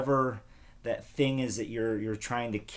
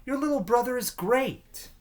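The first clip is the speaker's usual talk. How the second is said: put-on much higher, sweet girl voice; Chelsea's voice; 4 dB louder than usual. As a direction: sound like a chiding schoolgirl. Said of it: "Chelsea said".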